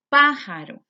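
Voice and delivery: "Pájaro" with PÁ punched high and loud; stress on PÁ. Shown as a demonstration. Teacher showing the antepenultimate accent, "Pájaro" stressed loudly on PÁ. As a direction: hit the first syllable of "Pájaro" hard. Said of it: "lleva el acento en pa".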